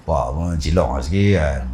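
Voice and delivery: low and soft, half under the breath; soft sly voice. Retold or imitated. Son slyly urging his father in a soft voice. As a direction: coax the father gently and slyly. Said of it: "Dia buat suara halus, suara besar".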